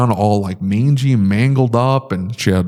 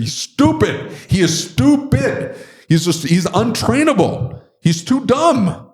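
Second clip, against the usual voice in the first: high-pitched, insistent outburst; exasperated; 11 semitones higher than usual. Real, not acted. Owner insisting the dog is untrainable, exasperated. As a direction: hammer it home, pitched way up.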